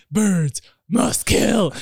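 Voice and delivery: a mock robotic killer chant; psycho killer voice. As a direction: drone it like a crazed killer. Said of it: "and I was like".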